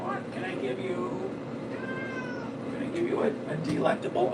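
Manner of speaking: very high, soft, half-whispered pet voice; baby talk to the cat. Acted. Owner offering the cat a treat in soft baby talk. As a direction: coax the cat sweetly.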